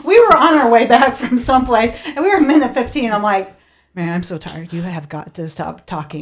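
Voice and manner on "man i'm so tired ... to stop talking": low, soft, worn-out request; retold weary plea, slowing down; drained, quietly begging for silence; exhausted plea. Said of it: "I was exhausted".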